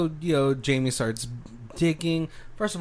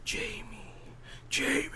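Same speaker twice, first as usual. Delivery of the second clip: higher, softer eerie call from the cursed mask; 8 semitones higher, 10 dB quieter than usual. Acted. The cursed mask luring Jamie, eerie soft call. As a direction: call her name like a haunting spirit.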